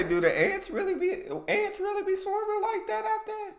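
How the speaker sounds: 웃으며 놀라서 높게 묻는 목소리; laughing amazed question. Real, not acted. Reacting to ant swarm video.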